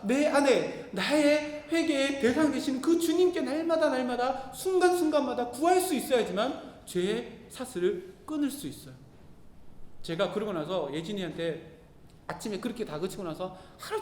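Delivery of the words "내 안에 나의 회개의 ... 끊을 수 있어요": slow, rising sermon emphasis; preacher building to a climax; preaching. Acted. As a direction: drive the point home from the pulpit.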